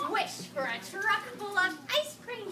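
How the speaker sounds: ordinary talk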